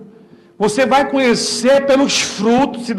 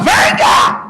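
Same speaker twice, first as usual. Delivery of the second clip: preaching shout; 12 semitones higher than usual. Acted. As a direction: cry out the invitation, much higher. Preacher calling the congregation.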